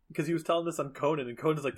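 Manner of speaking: ordinary talk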